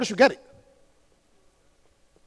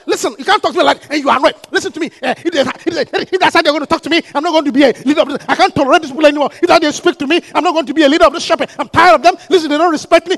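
fast, mildly indignant mock complaint; hammed-up offended shepherd; imitating a sulking leader; 8 dB louder than usual. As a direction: hurry through the grievance like an offended leader. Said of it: "one member said".

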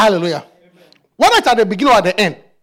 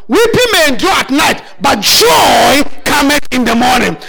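preaching; 3 semitones higher than usual. Acted. Preaching at full force.

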